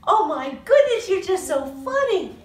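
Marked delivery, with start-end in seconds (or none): none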